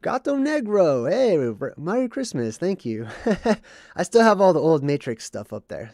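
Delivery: ordinary talk, nothing marked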